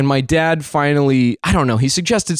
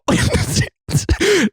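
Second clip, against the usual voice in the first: high-pitched burst of laughter. Real, not acted. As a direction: laugh mid-rant. Laughing at own story.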